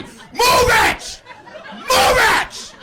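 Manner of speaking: very high, loud enraged bellow; screaming driver. Retold or imitated. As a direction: scream it right at the other driver. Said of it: "starts screaming at me".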